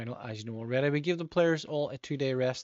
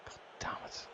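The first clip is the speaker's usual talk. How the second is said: a soft, unvoiced curse of frustration; whispered curse; 12 dB quieter than usual. Real, not acted. Gamer cursing under his breath as a penalty is given.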